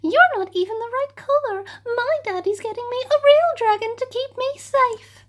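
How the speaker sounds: high, snooty and fast; sniffy princess. Acted. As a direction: look down your nose at him. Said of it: "she sniffed".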